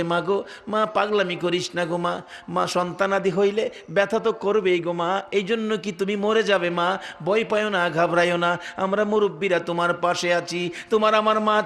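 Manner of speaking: ordinary delivery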